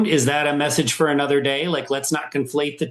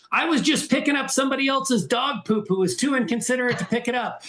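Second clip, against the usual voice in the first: mild mock yell; 9 semitones higher than usual. Runner imitates the woman calling back at him, a mild mock yell. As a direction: retell the dog owner's snappy comeback, half-heartedly. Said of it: "she yelled back over me".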